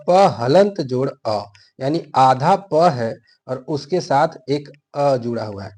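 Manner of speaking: slow, spelled-out प हलंत जोड़ अ; teacher slowing down to spell the letter; slow breakdown. Shown as a demonstration. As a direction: take it apart sound by sound, slowly. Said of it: "आप इसको देखिए प".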